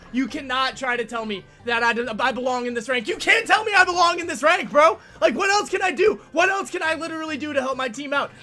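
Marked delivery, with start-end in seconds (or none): 3.1-8.3 high, pleading rant; exasperated protest. Real, not acted. Player who carried and still lost, exasperated high protest. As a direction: protest in raised pitch, really worked up. Protests rank after losing.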